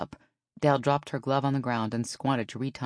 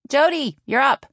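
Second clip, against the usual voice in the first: higher, louder call; 12 semitones higher, 9 dB louder than usual. A narrator's reading. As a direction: call the next batter. Calling out the batting order.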